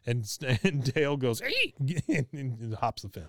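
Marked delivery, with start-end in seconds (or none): 1.4-1.7 very high sharp yelp; sudden shout. Retold or imitated. Dale shouts at Hank.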